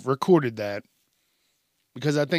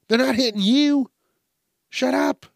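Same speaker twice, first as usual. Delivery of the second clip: high, loud, exasperated telling-off; exasperated scolding; 10 semitones higher, 6 dB louder than usual. Pretend. Host scolding an imagined screamer at a fight, exasperated. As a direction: scold a screamer in raised pitch.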